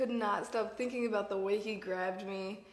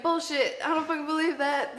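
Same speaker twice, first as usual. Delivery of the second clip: raised, loud retold scoffing; angry disbelief; 6 semitones higher, 6 dB louder than usual. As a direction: imitate someone indignantly refusing to believe it. Acting out his angry disbelief.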